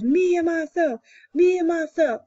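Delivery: high, loud proud boast; chest-out lion roar. A narrator's reading. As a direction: roar it like king of the forest. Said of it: "he roars".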